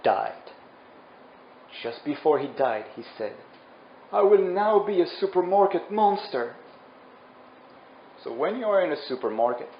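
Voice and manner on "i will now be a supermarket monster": higher-pitched theatrical proclamation; dying vow. Acted. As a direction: proclaim a last dramatic curse. Dying hero's final vow.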